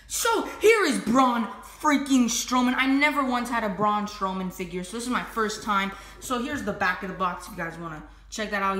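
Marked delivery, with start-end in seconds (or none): none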